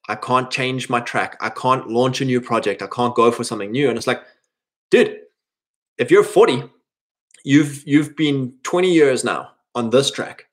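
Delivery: ordinary talk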